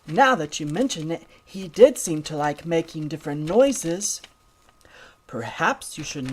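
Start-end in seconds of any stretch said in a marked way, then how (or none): none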